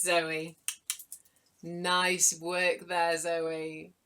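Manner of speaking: ordinary talk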